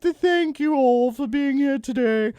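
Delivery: high, loud mock royal British voice; hammed-up British monarch addressing her guests; the Queen. Host showing how his British accent drifts, mock royal voice. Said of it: "it'll sound like the Queen".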